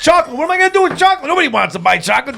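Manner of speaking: high-pitched comic whining; mock despair. Friend riffing on the chocolate job, mock despair. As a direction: wail it in hammed-up exasperation. Mock voice of hopeless candy seller.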